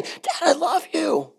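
put-on high, soft little-kid voice; adoring kids. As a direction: gush in a small, high voice like children greeting dad. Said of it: "My kids praised Daddy".

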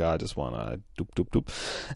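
soft, breathy mock come-on fading to a whisper; lustful murmur. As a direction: drool over him under your breath. [name] ogling Kane.

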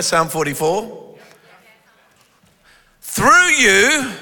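usual speaking voice

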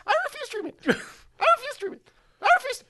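chipmunk-high sped-up tape; fast-forward voice. Acted. Imitating fast-forward tape playback.

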